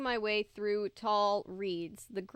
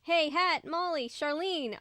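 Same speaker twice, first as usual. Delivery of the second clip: higher call to the friends; 6 semitones higher than usual. A narrator's reading. Calling friends in woods.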